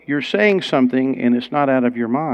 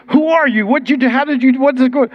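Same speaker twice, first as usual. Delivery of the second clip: much higher, rapid amazed questioning; bewildered questions; 10 semitones higher, 4 dB louder than usual. Retold or imitated. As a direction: tumble out the questions in shock. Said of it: "And he says".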